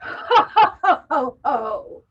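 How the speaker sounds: hearty ho-ho laugh. Shown as a demonstration. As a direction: belly laugh from the diaphragm for the group. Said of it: "laugh out your mouth".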